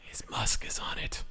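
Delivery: whispered aside. Real, not acted. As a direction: breathe it as a secret. Whispered joke.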